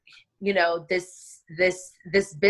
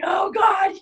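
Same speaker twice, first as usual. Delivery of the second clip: high, crying-edged wail; panicked oh God; 13 semitones higher, 3 dB louder than usual. Retold or imitated. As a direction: let it crack in mock despair. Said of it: "And I was like".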